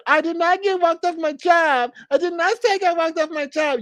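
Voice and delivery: high, whiny mock voice of a grown man denying it; mocking impression. Vlogger mocks a rival's denial in a high, whiny voice. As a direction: squeak out his denial like a sulking child.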